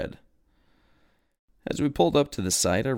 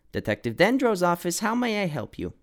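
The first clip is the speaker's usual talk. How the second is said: higher, louder chipper assistant on the phone; young assistant's phone greeting, pitched up; Codit answering phone; 9 semitones higher, 4 dB louder than usual. A narrator's reading. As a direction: pick up the call bright and eager.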